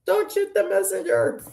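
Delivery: high squeaky pleading voice, laughing through it; mock plea. Reader pleading with Heather in a high mock-begging voice. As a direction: playfully beg Heather not to blame the bearer of bad news. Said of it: "you'd be like".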